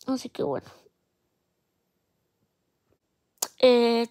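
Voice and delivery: tearful, quiet and shaky; crying softly while talking; snotty crying. Real, not acted. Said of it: "Llorando, check".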